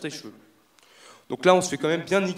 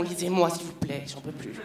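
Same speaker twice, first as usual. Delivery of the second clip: tired magazines; 4 dB quieter than usual. Acted. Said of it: "Fatigué, tu vois".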